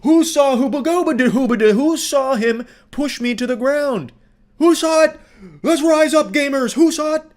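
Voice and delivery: imitating a frantic Karen calling for witnesses; mock rallying shriek. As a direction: whip up the crowd in a hysterical shout. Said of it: "She tries to, like, rally the people behind her".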